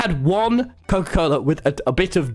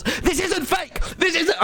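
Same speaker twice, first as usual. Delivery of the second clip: real high, indignant outburst; high-pitched protest; 13 semitones higher, slower than usual. Real, not acted. Streamer insisting to chat the story is true, high-pitched.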